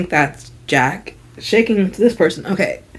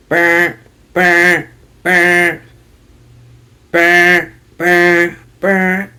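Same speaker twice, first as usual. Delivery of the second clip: droning, evenly repeated buzzer noise; monotone alarm buzz imitation; imitated alarm; 3 semitones lower, much slower than usual. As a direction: sound it like a phone alarm, on one note. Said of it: "It's an alarm".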